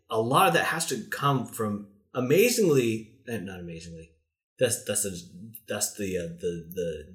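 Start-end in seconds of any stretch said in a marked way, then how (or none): 4.6-7.0 slurring, tipsy speech; words slurred and stumbling. Real, not acted. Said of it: "God, I'm so drunk right now".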